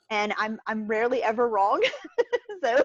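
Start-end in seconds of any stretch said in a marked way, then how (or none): 1.9-2.6 quick high laugh; a brief amused chuckle; short laugh. Real, not acted. Laughs at own boast.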